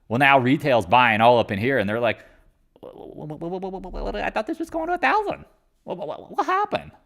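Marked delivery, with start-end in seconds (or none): 2.8-6.9 high stammering mock panic, breathy at first; panicked retail buyer. Mocking retail buyers as they panic over the drop, high and stammering. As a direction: stutter like a stunned buyer.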